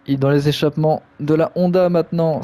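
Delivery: ordinary delivery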